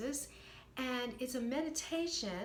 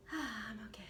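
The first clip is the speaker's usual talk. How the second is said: softer, felt and sighing; felt reassurance; 4 dB quieter than usual. Shown as a demonstration. Coach showing a felt mantra after a sigh, softer. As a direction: breathe out and mean it. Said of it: "feel it when you're saying".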